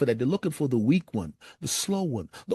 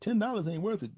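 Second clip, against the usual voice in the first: normal talking voice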